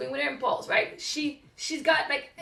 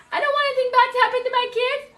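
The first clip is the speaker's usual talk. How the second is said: higher, louder and fast with worry; anxious worry; 9 semitones higher, 5 dB louder, faster than usual. Retold or imitated. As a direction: rush the fear out. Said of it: "I'm like".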